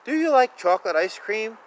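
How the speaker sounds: plain reading voice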